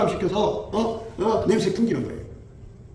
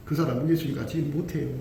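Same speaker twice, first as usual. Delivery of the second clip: usual speaking voice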